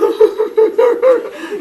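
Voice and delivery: high, loud comic sobbing; hammed-up wailing in bursts, falsetto; crying child. Boy left on the stairs, loud mock crying. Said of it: "and just crying on the stairs".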